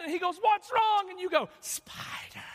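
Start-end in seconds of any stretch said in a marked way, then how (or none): 0.4-1.1 high, loud alarmed husband; alarmed shout. Retold or imitated. 1.6-2.4 breathless mock whisper; whispered spider. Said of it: "if you said that louder".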